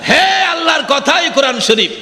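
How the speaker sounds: fiery waz exhortation; full-force preaching. Acted. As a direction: thunder the message at the crowd.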